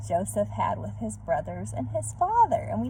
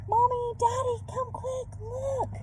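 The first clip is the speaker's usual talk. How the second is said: put-on much higher, excited kids' shouting; excited children crying out; 12 semitones higher than usual. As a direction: call out thrilled like little children. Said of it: "they cried".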